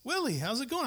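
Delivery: light up when you recognise him; answering the phone to a friend, delighted; cheerful greeting. Retold or imitated. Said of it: "I can say".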